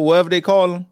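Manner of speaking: normal talking voice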